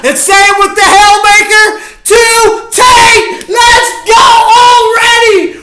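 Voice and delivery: high, loud ecstatic shouting and whooping; ecstatic celebrating. Real, not acted. Fan cheering his team's touchdown to viewers, ecstatic shout. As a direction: yell it out like your team just scored a bomb.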